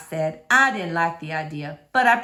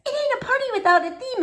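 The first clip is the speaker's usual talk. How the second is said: put-on high, quick little girl voice; faster than usual. Sweetie Pie insisting on a theme, quick and little. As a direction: rattle it off like a small child.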